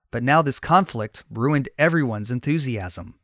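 plain reading voice

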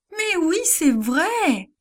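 high, slow insisting cry; insistent cry. A narrator's reading. Servant girl insisting to the doubters, high pleading cry. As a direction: plead to be believed. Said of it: "crie Rhodes".

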